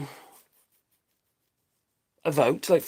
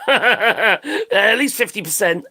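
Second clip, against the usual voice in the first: laughing; 3 semitones higher, 4 dB louder, much slower than usual. Real, not acted. Laughing at own joke.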